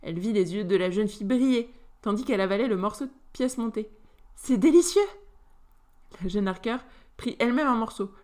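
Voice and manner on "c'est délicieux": bright, higher, louder delight; delighted exclamation. Acted. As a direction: exclaim with sudden pleasure. Goûte le gâteau, s'exclame. (ravie, s'exclame plus fort)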